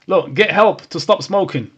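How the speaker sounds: ordinary delivery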